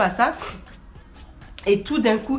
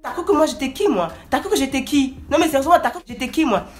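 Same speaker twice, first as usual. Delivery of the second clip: high, loud indignant scolding; outraged high scolding; telling him off; 8 semitones higher, 4 dB louder than usual. Retold or imitated. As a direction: put him firmly in his place. She tells him off.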